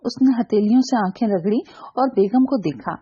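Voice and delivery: ordinary delivery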